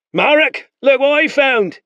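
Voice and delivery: high, loud triumphant call. Acted. Spearman calls Marek about the boy, loud and pleased. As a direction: shout across to your captain.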